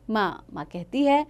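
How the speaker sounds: usual speaking voice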